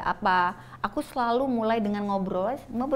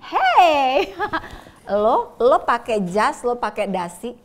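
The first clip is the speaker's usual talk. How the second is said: louder, laughing challenge thrown back; louder retold comeback to the man in a suit, with laughs; retold defiant retort; 2 semitones higher, 7 dB louder than usual. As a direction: snap back at him half laughing, daring him. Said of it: "aku bilang".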